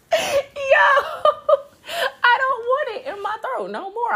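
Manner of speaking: high squealing laugh through the words; cry-laughing the words out high; wheezing laugh. Real, not acted. Laughing so hard at her own joke her voice squeaks up.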